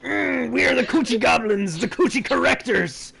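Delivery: loud, high goblin announcement. Acted. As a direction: proclaim like a goblin gang.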